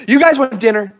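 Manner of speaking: ordinary delivery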